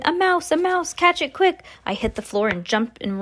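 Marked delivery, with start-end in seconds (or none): 0.0-1.6 high-pitched cries of alarm; urgent shouting. A narrator's reading. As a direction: urge the others to give chase, high-voiced. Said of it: "they were shouting".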